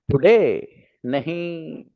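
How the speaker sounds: usual speaking voice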